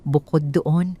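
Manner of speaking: ordinary delivery, nothing marked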